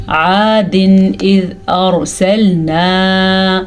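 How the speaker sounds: slow recitation, "عَادٍ" with a clear unhummed n; clear izhar. Shown as a demonstration. Teacher demonstrating izhar, "عَادٍ" slow and distinct. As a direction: recite slowly, holding the last ā of "أَرْسَلْنَا". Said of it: "ظاہر کر کے پڑھتے ہیں".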